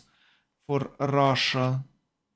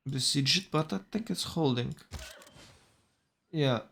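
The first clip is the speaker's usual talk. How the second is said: ordinary talk, nothing marked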